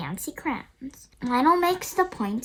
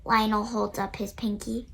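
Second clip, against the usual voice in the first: ordinary delivery, nothing marked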